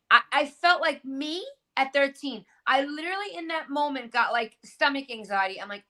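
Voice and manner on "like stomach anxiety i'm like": ordinary delivery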